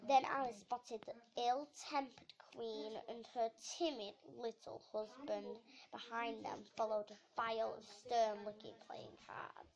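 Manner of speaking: plain reading voice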